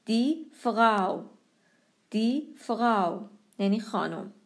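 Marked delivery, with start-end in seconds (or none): 0.1-1.2 slow فراو ending in an ow glide; au diphthong. Shown as a demonstration. Teacher demonstrating vowel pair, فراو with au. 2.1-3.2 slow فراو ending in an ow glide; au diphthong. Shown as a demonstration. Teacher demonstrating vowel pair, فراو with au.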